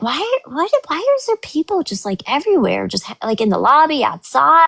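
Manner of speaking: high, confused wondering; puzzling over the crowd; baffled. Retold or imitated. Arriving at a packed lobby, baffled and high-pitched.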